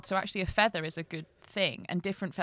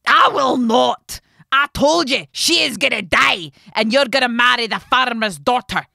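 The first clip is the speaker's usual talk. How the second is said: loud, high defiant mother; angry refusal; 5 semitones higher, 12 dB louder than usual. A narrator's reading. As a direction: dig in and refuse at full volume.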